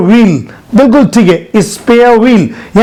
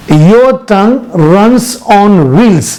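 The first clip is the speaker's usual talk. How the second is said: slow model sentence; much slower than usual. Shown as a demonstration. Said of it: "इसकी English है".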